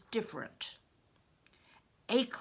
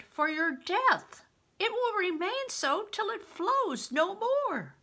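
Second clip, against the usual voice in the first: higher, louder widow pressing a grave warning; urgent old widow; 7 semitones higher, 5 dB louder than usual. Acted. Widow warning Crispin his blood means death, urgent and rising. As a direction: make him see the danger.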